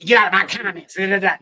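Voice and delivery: mocking, sassy snap at commenters; sassy mimicry. Host mimicking the politician's talk to commenters, sassy. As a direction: imitate someone haughtily shooing people off. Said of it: "he sounds just like when a".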